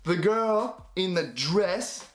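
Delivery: slow and clear. Retold or imitated. Tourist explains to French bridesmaids, slow and clear. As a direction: spell it out slowly for foreigners. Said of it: "I say".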